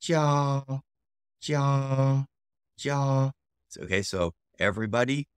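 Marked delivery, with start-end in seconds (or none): 0.0-3.3 JIA held high and level; long, steady high JIA; first tone. Shown as a demonstration. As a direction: sustain JIA on one high pitch. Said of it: "first tone".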